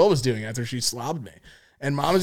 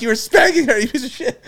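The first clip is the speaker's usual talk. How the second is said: high-pitched scolding imitation; mock angry mom; 10 semitones higher than usual. Mom scolding son for what she heard, mock anger. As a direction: act out an outraged mother accusing.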